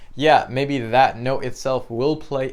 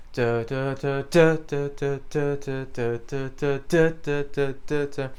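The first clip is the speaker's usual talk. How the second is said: each da attacked even more forcefully; marcato da's; slower than usual. Shown as a demonstration. Teacher showing marcato after the accent, sharper forceful da's. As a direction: hit every da hard and sharp, marcato style. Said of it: "marcato, yeah, it's literally the exact same thing as an accent, but with more, um, stress and more attack".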